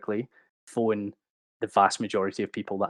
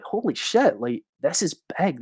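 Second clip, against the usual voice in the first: much higher, a burst of retold amazement; amazed exclamation; 6 semitones higher than usual. Recalling the growing phishing file to the host, amazed. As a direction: let the realization jump out high. Retells realizing the scale.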